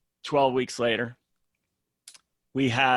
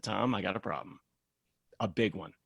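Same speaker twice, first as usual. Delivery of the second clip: softer and lower, heavy admission; quiet grim confession; 4 semitones lower, 6 dB quieter than usual. Retold or imitated. Calling the client Tom about the mistake, quiet and grim. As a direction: drop the voice, deliver bad news.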